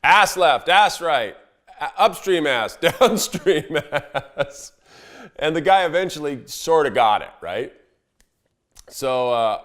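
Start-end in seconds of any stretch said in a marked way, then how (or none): 0.0-4.7 higher and louder, cracking up; laughing call-outs. Retold or imitated. Guide calling directions while laughing. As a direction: call the line through laughter. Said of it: "him saying".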